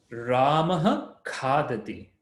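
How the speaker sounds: slow "Ramah khadati" with a breathy h; slow, emphasized. Shown as a demonstration. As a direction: say "Ramah khadati" slowly, with a clear h. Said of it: "I'm emphasizing it a little bit more".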